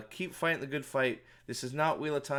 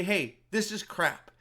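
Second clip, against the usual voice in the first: slow blunt callout; 6 semitones higher, 3 dB louder, slower than usual. Retold or imitated. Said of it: "and say".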